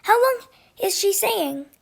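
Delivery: plain reading voice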